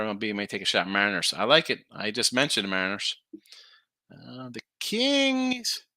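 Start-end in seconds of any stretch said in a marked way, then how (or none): 4.1-5.8 very slow, high stretched words; drawn-out callout. Real, not acted. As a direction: stretch it like an announcer.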